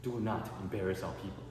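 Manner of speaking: solemn warning. Retold or imitated. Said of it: "I said".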